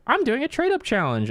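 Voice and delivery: put-on high, flat demanding kid voice; rude kid. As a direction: blurt it like an entitled kid. Rude kid quoted at booth.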